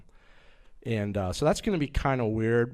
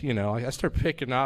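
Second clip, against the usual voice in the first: ordinary delivery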